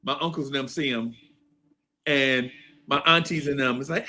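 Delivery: normal talking voice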